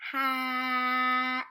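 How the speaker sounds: "Hat" with a long held vowel and a quick final t; sounded out slowly. Shown as a demonstration. Teacher modelling a word to read, "Hat" sounded out slowly. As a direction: stretch the middle of "Hat" on one level note, loud. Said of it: "we're not going to stop on the sound".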